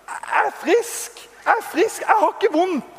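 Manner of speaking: joyful disbelief. Retold or imitated. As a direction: burst out with delight at being healed. Shouting he is healed.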